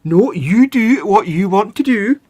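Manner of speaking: high, loud, slow mock Scottish scolding; Scottish accent. Host plays friend's annoyed wife, mock Scottish accent. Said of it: "getting spoken to in Scottish accent".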